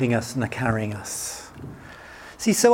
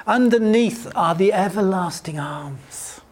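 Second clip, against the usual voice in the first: normal talking voice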